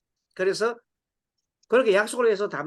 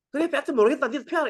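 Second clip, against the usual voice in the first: exasperated wife; 7 semitones higher, faster than usual. Retold or imitated.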